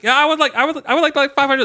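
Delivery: put-on high, loud excited kid; hammed-up yelled agreement; yelling back. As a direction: imitate a boy shouting his eager answer. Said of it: "they're just yelling at each other".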